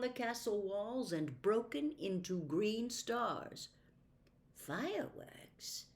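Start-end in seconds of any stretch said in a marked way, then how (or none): 4.5-5.9 whispered puzzled question. Acted. Jill puzzled by green stars, whispered question. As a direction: softly wonder aloud. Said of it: "said Jill in a puzzled voice".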